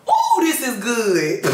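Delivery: high, slow and delighted ooh. Real, not acted. A woman reading Exodus, thrilled by Aaron's excuse, slow and delighted. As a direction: savor the passage out loud with delight.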